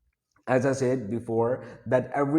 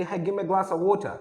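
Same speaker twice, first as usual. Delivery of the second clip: American-style "water" with a sounded final r; American accent; 5 semitones higher than usual. Shown as a demonstration. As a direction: put on an American accent, pitched higher. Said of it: "if I talk about American accents".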